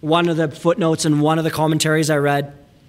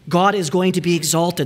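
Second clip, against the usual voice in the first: ordinary talk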